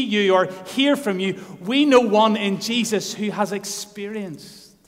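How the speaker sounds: loud, rising sermon cry; full-force preaching. Acted. Preacher urging his church, loud and rising. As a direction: preach it hard to the congregation.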